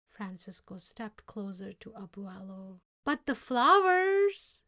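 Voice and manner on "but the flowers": faltering protest. Acted. Francisco protests timidly.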